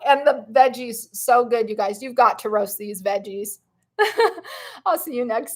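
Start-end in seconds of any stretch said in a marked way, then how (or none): 4.0-4.8 high, light giggle; parting laugh. Real, not acted. Host saying see you next time to viewers, high giggle. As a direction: giggle high before signing off.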